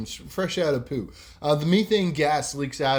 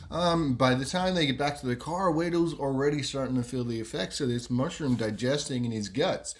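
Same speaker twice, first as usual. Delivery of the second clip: normal talking voice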